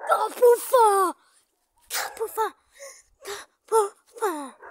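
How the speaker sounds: high, loud, slow cry of kapufa; excited sports commentary. Real, not acted. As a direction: shout it like a commentator. Hit the post, reacting.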